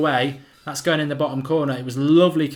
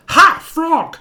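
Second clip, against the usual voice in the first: terrible voice; 10 dB louder than usual. Pretend. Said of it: "uh, that was terrible".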